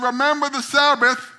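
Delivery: high, loud divine proclamation; booming voice from the sky. Acted. A voice from the sky warns the lottery winner, booming. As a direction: boom it down like God from the clouds. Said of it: "a voice came out and said".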